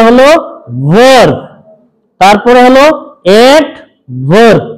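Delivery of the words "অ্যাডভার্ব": অ্যাডভার্ব spoken slowly and distinctly; careful model pronunciation. Shown as a demonstration. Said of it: "আগে উচ্চারণটা আমার মুখ থেকে শোনো". (ধীরে স্পষ্ট করে অ্যাডভার্ব)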